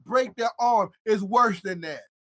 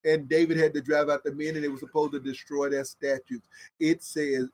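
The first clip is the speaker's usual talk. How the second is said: ordinary talk, nothing marked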